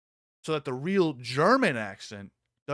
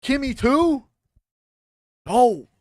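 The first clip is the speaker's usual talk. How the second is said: shocked; 8 semitones higher, 8 dB louder, slower than usual. Real, not acted. Shocked reaction to clip.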